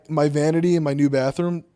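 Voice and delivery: ordinary talk, nothing marked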